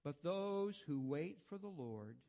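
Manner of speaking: quiet, unhurried reading of the psalm; soft scripture reading. Acted. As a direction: let the words wash over them gently. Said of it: "I'm just gonna read this to you".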